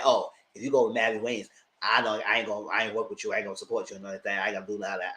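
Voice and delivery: lightly voiced sulky man; mock him. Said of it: "he's saying that".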